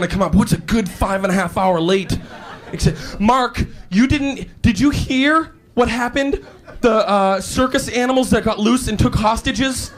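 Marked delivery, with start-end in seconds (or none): 3.2-9.9 high, incredulous storytelling, slow at first; mock-earnest excuse. Late employee telling the boss an absurd lie, mock earnest. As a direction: sell a far-fetched lie with wide-eyed earnestness.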